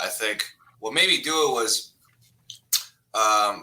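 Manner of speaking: ordinary talk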